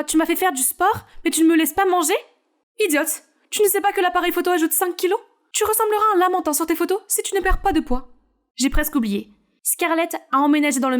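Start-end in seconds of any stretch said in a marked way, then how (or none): 0.0-8.1 higher character voice, scolding and mocking; exasperated scolding. Scarlett complaining to the trainer, exasperated scolding. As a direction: scold the whining girl about the camera. Strict diet argument voiced.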